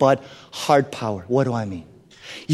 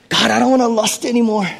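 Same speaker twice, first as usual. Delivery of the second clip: mock struggling prayer; 5 semitones higher than usual. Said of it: "This is your picture".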